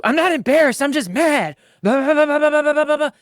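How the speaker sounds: high, loud hammed-up protest; mock angry wife. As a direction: play the wife yelling back, overdone. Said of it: "she's yelling".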